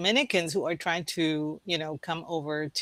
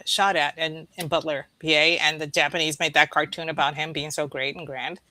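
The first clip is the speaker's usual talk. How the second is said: ordinary talk, nothing marked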